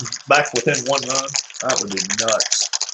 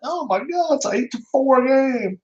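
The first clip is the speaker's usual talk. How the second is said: excited outburst; 13 semitones higher than usual. Real, not acted. Streamer reacting to the game score, excited.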